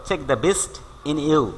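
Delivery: slow, deliberate reading of the example. Shown as a demonstration. Teacher reading an example to students, slowly. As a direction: spell it out slowly for the class. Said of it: "teacher repeats proverb slowly".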